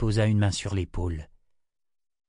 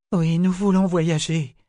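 eager request; 8 semitones higher, 5 dB louder than usual. A narrator's reading. Nietzsche asks the creature.

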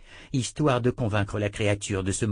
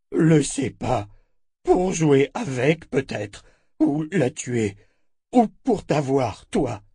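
halting creature voice, slightly raised; monster captive, evasive; 5 semitones higher, 5 dB louder, slower than usual. Acted. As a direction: offer guesses in slow broken monster speech. Monster character in narrated story.

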